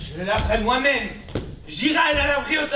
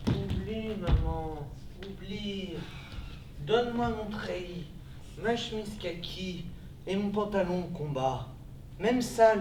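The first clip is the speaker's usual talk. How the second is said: soft pleading; 7 semitones lower, 6 dB quieter than usual. Acted. Son pleads softly with mother.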